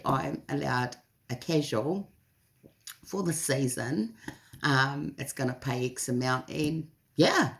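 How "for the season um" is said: ordinary delivery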